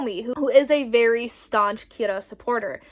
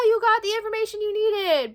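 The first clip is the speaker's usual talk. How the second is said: mock cheerful; 10 semitones higher than usual. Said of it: "Everyone's like".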